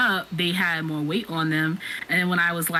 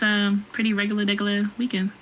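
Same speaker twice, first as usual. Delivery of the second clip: ordinary talk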